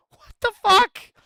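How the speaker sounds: very high, loud, drawn-out exclamation; incredulous high-pitched yell; outraged disbelief. Real, not acted. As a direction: cry out slowly in sheer disbelief. Outrage at that response.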